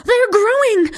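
high panicked shriek. A narrator's reading. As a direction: shriek in sudden alarm. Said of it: "shrieked one of the witch kids".

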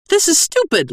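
very high, exasperated objector; protesting interruption. Acted. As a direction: break in with a squeal of protest. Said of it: "Lomo broke in".

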